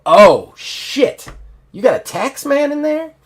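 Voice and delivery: loud outburst leaping high on the question; shocked surprise. Acted. Woman learning the stranger knows taxes, acted shock. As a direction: react in loud, high-pitched astonishment.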